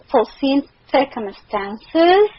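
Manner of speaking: "foreseen circumstances" with every vowel stretched long and slow; drawn out vowels. Real, not acted. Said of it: "Why are you stretching the vowels".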